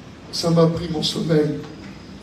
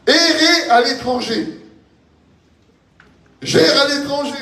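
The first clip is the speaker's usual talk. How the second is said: raised, high preacher's cry; fervent preaching; 10 semitones higher, 3 dB louder than usual. Real, not acted. Preacher hammering a Bible phrase to the brothers, fervent.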